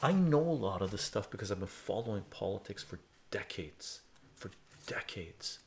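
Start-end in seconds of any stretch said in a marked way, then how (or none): 4.4-5.6 breathy whispered repeat, slowed; hushed emphatic echo; whispered aside. Acted. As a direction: murmur it again under your breath. Whispered repeat of own phrase.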